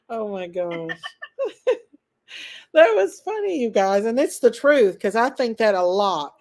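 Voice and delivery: usual speaking voice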